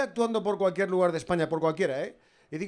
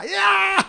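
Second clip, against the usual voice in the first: loud, very high held squeal; hammed-up yell; high shriek; 14 semitones higher, 9 dB louder than usual. End of mock Murcian contestant reply.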